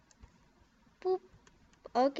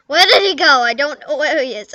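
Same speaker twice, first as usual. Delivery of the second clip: loud, high frantic searching; 3 semitones higher, 13 dB louder than usual. Real, not acted. Loud and high while hunting for a character in the game. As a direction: call out where did he go.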